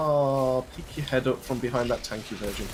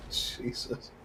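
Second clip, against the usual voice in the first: ordinary talk, nothing marked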